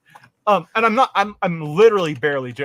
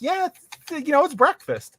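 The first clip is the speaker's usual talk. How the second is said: high flight attendant voice; 11 semitones higher than usual. Retold or imitated. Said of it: "she's like".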